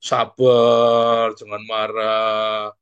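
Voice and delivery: very slow, droning chant; exaggerated, stretched-out plea for patience; mock calming. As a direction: drag the vowels out loudly on one note. Mimicking annoying advice to be patient.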